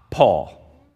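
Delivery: ordinary talk, nothing marked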